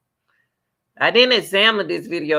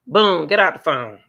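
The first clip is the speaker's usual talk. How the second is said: ordinary delivery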